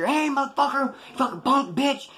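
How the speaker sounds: high-pitched mock outraged yell; angry yell imitated. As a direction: act out someone yelling after a thief. Angry stranger shouts at thief.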